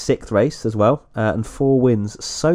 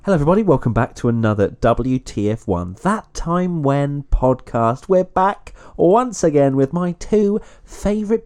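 podcast intro; 4 semitones higher than usual. Acted.

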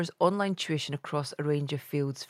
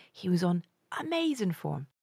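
ordinary talk, nothing marked

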